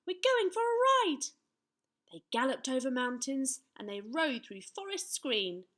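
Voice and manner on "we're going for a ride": put-on very high, loud boy knight; little knight. A narrator's reading. Young knight summons his steed, high and bold. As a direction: call the horse in a high child's voice. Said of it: "said Sir Scallywag".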